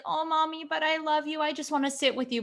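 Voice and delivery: imitating a whiny daughter. Mom imitates her daughter begging for cuddles, mild whine. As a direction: lightly voice the clingy child begging. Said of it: "she whines".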